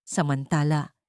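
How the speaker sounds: ordinary delivery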